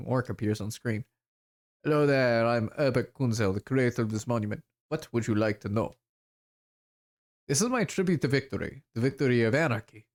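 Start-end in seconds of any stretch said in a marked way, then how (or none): 1.8-10.1 attempted German accent sliding toward French. Orc artist introducing the monument, German accent. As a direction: put on a shaky stage German accent. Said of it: "listen to an actual German accent".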